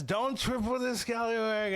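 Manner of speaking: high-pitched mock scolding; Shady. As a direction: imitate a nagging coach's warning. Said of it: "Shady just says".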